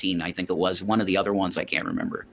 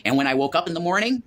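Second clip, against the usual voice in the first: ordinary talk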